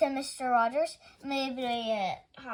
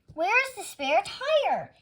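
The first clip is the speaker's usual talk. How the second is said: high, slow, loud question; alarmed demand; 10 semitones higher, 5 dB louder, slower than usual. A narrator's reading. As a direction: demand it in dismay. Man finds trunk empty.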